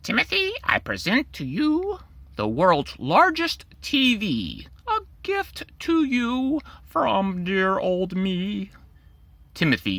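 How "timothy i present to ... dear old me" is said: high, eerie creature voice, slowing at the end; creepy TV creature. Acted. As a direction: present the gift like a sly showman creature. TV creature offers the giant TV.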